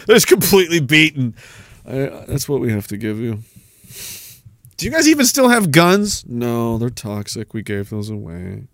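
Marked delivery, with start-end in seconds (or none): 1.9-3.4 quiet beaten mumble. Acted. 6.3-8.6 meek soft reply. Acted. Recruiter answering meekly.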